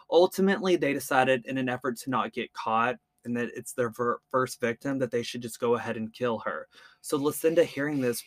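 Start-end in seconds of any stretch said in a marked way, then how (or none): none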